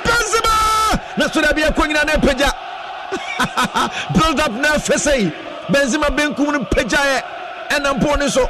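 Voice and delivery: rising, shouted play-by-play; excited commentary. Acted. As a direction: call the attack as it builds. Live play-by-play, build-up to a chance.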